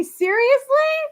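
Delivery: high, loud incredulous question; can't believe it; shocked seriously; disbelief. Real, not acted. Disbelief at fake fundraiser.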